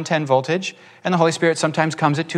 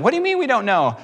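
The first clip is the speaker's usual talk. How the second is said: higher, disbelieving challenge; incredulous retort; 9 semitones higher, faster than usual. Retold or imitated. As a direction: push back in disbelief. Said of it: "And I'm like".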